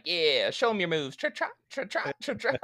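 high, singsong coaxing with bouncy trot sounds; playful horse-rider. Retold or imitated. Rider to his horse leaving the courthouse, gleeful mock coaxing. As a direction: tease with a prancing, high-pitched lilt.

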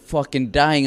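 usual speaking voice

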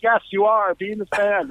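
high, emphatic retold plea; begging. John answering the drummer's tease, begging insistently. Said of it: "him and Simon were begging me".

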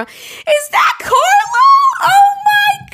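high, loud shrieking surprise; excited squeal. Retold or imitated. Mom spotting Carlo on the Ring camera, squealing excitedly. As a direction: squeal it like a starstruck fan.

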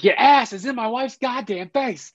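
high, brash New York guy repeating himself; tough New Yorker. Retold or imitated. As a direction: hammer it home like an offended New Yorker. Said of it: "the quintessential New Yorker kinda guy".

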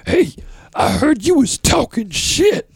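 high, slow falsetto Kathy confronting him; imitating Kathy. Comic imitates the angry booker in a high, slow falsetto. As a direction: squeak out the accusation slowly. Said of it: "Kathy comes storming over".

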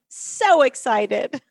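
slow, drawn-out excited echo. Real, not acted. Host repeating her joy to Erin, slow and excited. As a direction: let the excitement linger on so.

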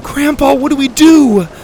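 high, loud frantic cry for help; panicked shout. A narrator's reading. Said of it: "Grandpa shouted".

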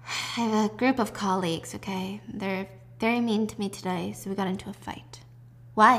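subdued, quiet heart-to-heart; soft confiding. Retold or imitated. Mother and daughter confiding on the couch, soft and subdued. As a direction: speak softly, drained after the quarrel.

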